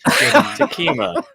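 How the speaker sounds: drawn-out, high solemn name; dramatic reading. Pretend. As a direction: savor the name slowly. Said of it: "really kinda get into it".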